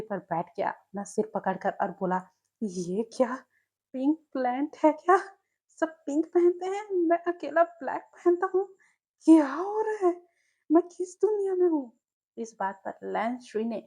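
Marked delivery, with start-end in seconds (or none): 2.6-11.8 exasperated. Acted. Wing despairs, head in hands.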